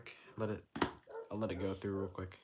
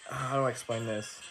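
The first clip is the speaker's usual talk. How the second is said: usual speaking voice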